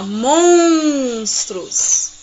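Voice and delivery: MONSTROS with a long, loud nasal ON; reading aloud. Shown as a demonstration. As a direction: stretch the first syllable of MONSTROS. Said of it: "Relê essa palavra em voz alta".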